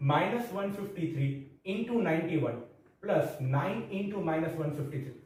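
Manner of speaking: reading the question out very slowly, word by word; slow dictation. Acted. Teacher dictating the question to students, very slow. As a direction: spell the sum out slowly for the class to copy.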